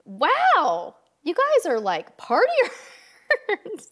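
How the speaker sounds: high, drawn-out mock awe at the partiers; mock amazed. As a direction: gush it slowly like an impressed onlooker. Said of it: "We were just always like".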